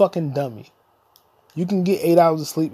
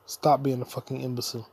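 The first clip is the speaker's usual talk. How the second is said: normal talking voice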